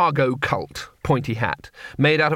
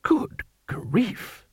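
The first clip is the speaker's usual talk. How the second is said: whispered good grief; 8 semitones higher, 4 dB quieter than usual. A narrator's reading. Ridcully reacts, unvoiced.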